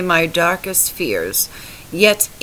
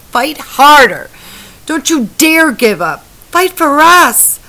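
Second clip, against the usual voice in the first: much higher and louder commands; desperate shout; 9 semitones higher, 10 dB louder than usual. A narrator's reading. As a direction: yell at him to keep fighting. Wife yells at dying husband.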